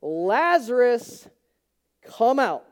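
loud, slow command; loud call. Retold or imitated. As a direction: call out slowly and loudly. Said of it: "in a loud voice".

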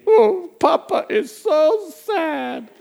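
high, slow hammed-up wail; fake crying. Grandpa guilt-tripping his grandson, exaggerated mock crying. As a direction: put on a pitiful, drawn-out mock cry. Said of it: "And then I cry, faint".

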